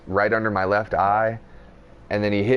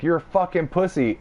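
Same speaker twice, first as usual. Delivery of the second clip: taunt; 8 semitones higher than usual. Retold or imitated. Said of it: "and I'm like".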